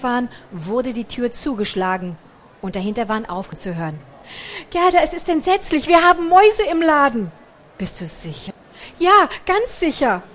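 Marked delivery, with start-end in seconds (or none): 4.7-7.3 higher, louder horrified cry; panicked outburst. A narrator's reading. Margarete telling Gerda about the mice, horrified and loud. 7.8-8.5 lower, much softer doubt; quiet question. A narrator's reading. Frau Fröhlich quietly asking if it's true, hushed. 9.0-10.2 higher, louder horrified cry; panicked outburst. A narrator's reading. Margarete telling Gerda about the mice, horrified and loud.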